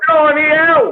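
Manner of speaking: loud, very high Geordie exclamation; exaggerated Geordie shriek; northern English accent. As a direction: squeal it in hammed-up northern English. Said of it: "spent a bunch of time in the north".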